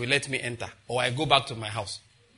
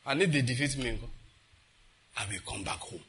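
quoted quiet ending; 3 semitones lower, 6 dB quieter than usual. Retold or imitated. Preacher retelling how the president ended it, quiet ending. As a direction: drop low and soft by the end. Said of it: "There's a way he ended it".

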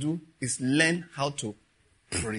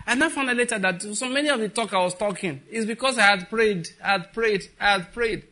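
raised, emphatic preaching voice; 6 semitones higher, 4 dB louder than usual. Acted. Preacher pressing the congregation, raised preaching. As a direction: drive the point home, louder.

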